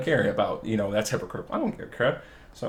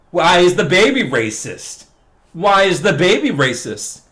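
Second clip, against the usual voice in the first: mock outrage; 7 semitones higher, 6 dB louder than usual. Real, not acted. Rhetorical outraged question.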